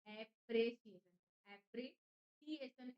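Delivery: Appreciation said slowly, syllable by syllable. Shown as a demonstration. Teacher breaking the word apart slowly for students. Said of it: "तोड़ तोड़ के याद रखिए".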